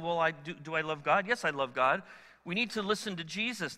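ordinary talk, nothing marked

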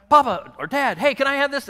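put-on high, eager child's plea; begging kid. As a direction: ask like a kid tugging a sleeve. Kids begging parent in store.

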